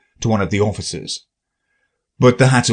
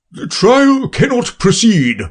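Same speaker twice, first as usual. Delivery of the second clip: grave voice; 12 semitones higher, 3 dB louder than usual. Acted. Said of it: "said the king in a very grave voice".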